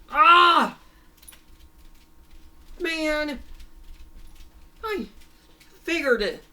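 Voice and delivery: high-pitched frustrated outburst; furious villain. Acted. Master watches his plan fail, mad outburst. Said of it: "he's just completely mad".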